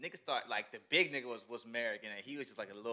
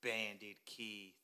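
slow, drawn-out catchphrase, breaking into laughter; slow mock-dramatic impression; Bandit Keith; 5 semitones lower, 9 dB louder, much slower than usual. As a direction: stretch each word out, savoring the joke. Echoing the character's catchphrase.

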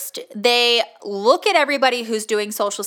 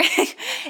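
breathy little laugh. Real, not acted. Laughing at own joke.

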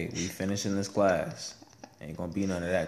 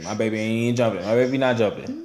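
emphatic; 3 semitones higher, 8 dB louder than usual. Real, not acted. Dad insisting to wife, emphatic and louder. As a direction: put your foot down on it.

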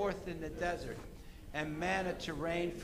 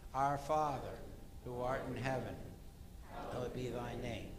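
quiet, lower unison prayer; prayer recitation; 6 semitones lower, 5 dB quieter than usual. Acted. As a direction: recite it softly, reverently. Lord's Prayer together.

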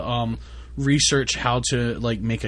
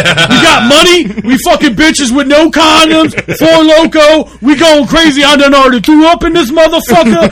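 screaming, hyped-up party shout; hype yelling; 14 semitones higher, 15 dB louder than usual. Pretend. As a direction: yell it like you're wild. Hyped yelling after mock calm.

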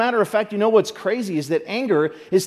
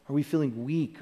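ordinary talk, nothing marked

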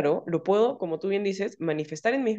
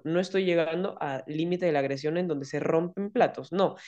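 normal talking voice

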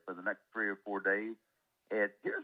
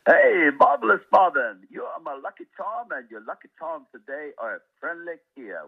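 hammed-up Irish greeting, pitched high; Irish accent; 5 semitones higher, 13 dB louder than usual. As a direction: call out in your best put-on Irish accent. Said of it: "That's my best Irish accent".

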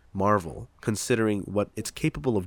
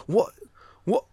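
mock British snob, scandalized and dismissive; snobbish British accent; 5 semitones higher than usual. Snob scorning comic book films, mock British accent. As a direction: sneer it in a put-on British accent. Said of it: "all snobs are defaults to have that accent".

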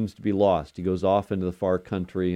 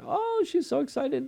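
excited; 3 dB quieter than usual. Retold or imitated. Grandpa mimics granddaughter's excitement.

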